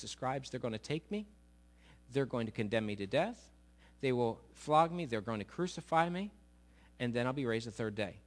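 hushed, steady voice; calm, quiet telling. Acted. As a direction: break hard news gently to friends. Jesus calmly tells the news.

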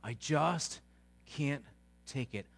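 low, soft and slow, worn out; a tired sigh of someone begging for peace; spent, pleading for calm; weary plea. Retold or imitated. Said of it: "We said".

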